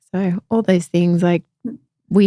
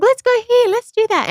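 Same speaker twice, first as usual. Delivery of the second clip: mock perky sister voice, much higher; energizer bunny sister. Sister dragging her around, in a high peppy mock voice. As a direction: bounce it up high like a hyper sister. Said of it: "she's such an energizer bunny".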